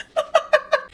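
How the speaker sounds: a sudden high cackle of real laughter; high burst of laughter. Real, not acted. Cracking up at her own jab at the elder, high laugh.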